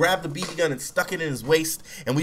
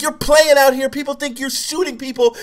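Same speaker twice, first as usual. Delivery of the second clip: high, loud, furious scolding; mad cop yelling; 12 semitones higher, 8 dB louder than usual. Retold or imitated. Cop scolding boy playing with a gun, angry yelling. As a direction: chew the kid out at full volume. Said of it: "the cop was so mad".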